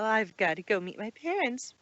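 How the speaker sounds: ordinary delivery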